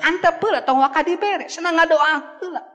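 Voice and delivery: high mother's voice, firm advice; the mother telling dad to wait; mother insisting the child prays first; voicing the mother.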